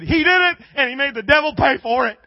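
high, loud triumphant holler; imitated woman's jubilant comeback; triumphant cry. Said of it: "And she said".

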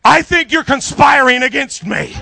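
high, very loud hammed-up protest; mock loud accusation. Skeptical diner accuses her friend, mock outraged shout.

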